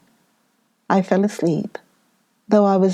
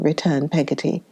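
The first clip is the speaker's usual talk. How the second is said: ordinary delivery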